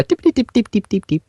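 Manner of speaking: tippity tip jumped way up high, then dropping; tippity typing, high. Shown as a demonstration. Showing the right typing sound to the hosts, high. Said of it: "There you go".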